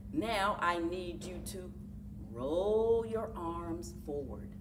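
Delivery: ordinary delivery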